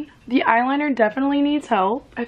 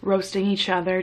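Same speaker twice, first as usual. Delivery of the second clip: ordinary delivery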